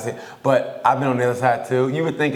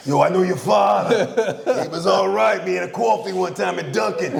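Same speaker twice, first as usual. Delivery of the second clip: put-on New York accent; 6 semitones higher than usual. New York accent boasting to a fan's son. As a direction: play a tough New York guy who knows your father. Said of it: "I always get to my New York accent".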